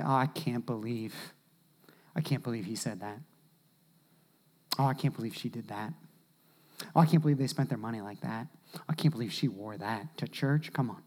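tut-tut quietly behind their back; gossipy murmur. Acted. Churchgoers gossiping about others, soft and catty. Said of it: "We say".

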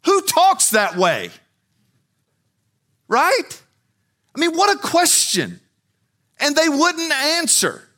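very high and loud, slow, with an incredulous No!; impassioned preaching. Real, not acted. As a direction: hammer the obvious answer home. Preaching at full force.

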